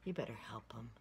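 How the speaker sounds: usual speaking voice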